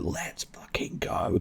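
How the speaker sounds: unvoiced mock raucous cheer; hyped whisper. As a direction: breathe it out like a hushed hype chant. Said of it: "something a bit more, like, raucous".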